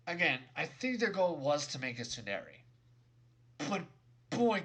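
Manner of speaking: ordinary talk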